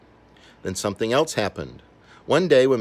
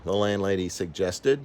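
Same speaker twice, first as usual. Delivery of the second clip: usual speaking voice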